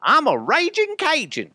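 high, loud Cajun bellow; hammed-up raging Bayou boast; Brandon Stokley Cajun voice. Host doing his signature Stokley line in a loud, high Cajun voice. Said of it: "that's the line I always go back to".

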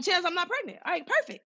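high-pitched, breezy relief; retold high relieved voice. Telling the nurse she's not pregnant, high and relieved. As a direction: squeak out relief like it's nothing.

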